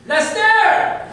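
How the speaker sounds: very high, loud drawn-out holler. Retold or imitated. Strict dad calling his son a second time, high full-voiced yell. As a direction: belt the name out from far away, imitating dad. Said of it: "That was call number two".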